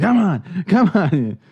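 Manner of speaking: high excited horse-race cheering; Kramer impression. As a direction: egg on a losing horse, Kramer style. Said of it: "I was like Kramer".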